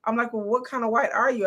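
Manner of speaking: normal talking voice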